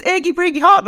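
Achy Breaky Heart high and emphatic; repeating Achy Breaky Heart. Retold or imitated. Mum unaware son is laughing, Achy Breaky Heart repeated high. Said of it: "I was".

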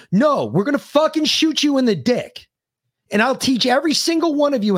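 rising fury, pushing each threat harder; heated rant. Real, not acted. Ranting at liberal viewers, voice pitched high in fury. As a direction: pitch climbing high, voice tight with anger.

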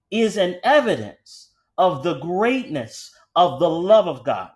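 measured pulpit emphasis with long pauses; emphatic preaching. Real, not acted. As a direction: drive the point home slowly, phrase by phrase. Preaches the main point.

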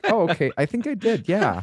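put-on high, fast kid voice; childish little brother voice. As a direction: chirp it like a gullible kid. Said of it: "he was just like".

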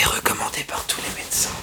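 whispered right in your ear; breathy ASMR whisper, close and intimate. Pretend. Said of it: "je fais de l'A S M R".

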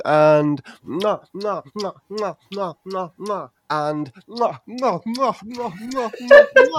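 string of smacking air kisses; blowing an air kiss. Pretend. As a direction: smother the baby in kisses. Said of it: "hold you in my arms".

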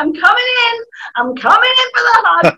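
high, eager mock warning; teen teasing a sibling, high and eager; playful hug threat. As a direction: announce the incoming hug with glee. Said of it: "You're like".

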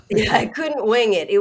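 real laugh through the words. Real, not acted. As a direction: laugh it out while agreeing. Laughing after friend's quip.

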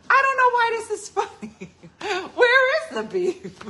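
put-on very high, loud, laughing old lady squeak; imitating grandmom puzzled at the joke; tiny grandma voice. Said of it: "And she was like".

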